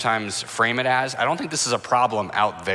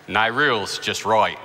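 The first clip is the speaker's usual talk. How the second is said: mock Aussie waiter accent; hammy put-on Australian twang; fake Australian accent. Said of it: "in a very fake Australian accent".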